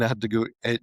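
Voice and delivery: ordinary delivery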